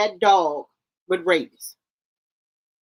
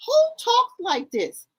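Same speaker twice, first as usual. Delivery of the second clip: high-pitched disbelief; incredulous; 12 semitones higher than usual. Real, not acted. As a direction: ask it in high, appalled disbelief. Appalled reaction to the clip's talk.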